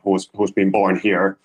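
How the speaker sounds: ordinary delivery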